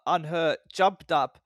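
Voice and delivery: plain reading voice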